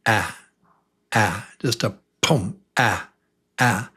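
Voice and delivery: short punchy "a" like a fist. Shown as a demonstration. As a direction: hit the "a" short and quick. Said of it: "is just a short sound like a fist".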